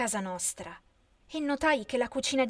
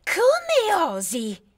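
outraged; 7 semitones higher, 6 dB louder, much slower than usual. A narrator's reading. Anna furious at the insult.

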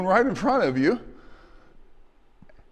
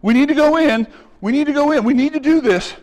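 louder, higher urgent plea; urgent pleading; 4 semitones higher, 4 dB louder than usual. Retold or imitated. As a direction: urge them on, insistent. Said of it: "Joshua and Caleb are like".

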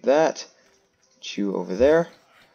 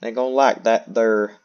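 put-on drawling accent. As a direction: put on a broad, twangy "ain't gonna" accent. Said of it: "I hate doing this accent".

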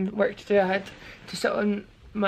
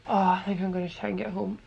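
normal talking voice

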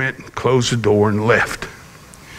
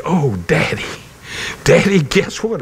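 put-on higher-pitched, eager child bursting with news; excited child's announcement; excited little girl; 5 semitones higher than usual. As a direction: lightly imitate a thrilled daughter calling Daddy. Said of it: "you were so excited".

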